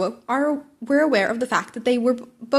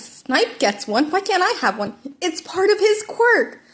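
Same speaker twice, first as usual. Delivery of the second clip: slow, high, whining complaint; whiny protest; 8 semitones higher, slower than usual. Acted. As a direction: plead like a sulky kid. Student whining argument.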